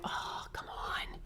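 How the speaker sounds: whispering, half-joking disbelief; hushed mock shock; whispered disbelief. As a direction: whisper it like you can't believe it. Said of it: "And I'm like".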